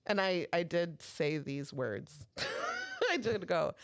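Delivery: ordinary talk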